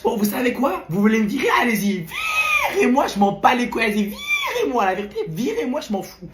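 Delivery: defiant outburst shooting up into squeaks; cracked, broken voice. Acted. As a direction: dare them, voice cracking high. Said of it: "soit tu reprends ta voix normale". (voix cassée qui part dans les aigus)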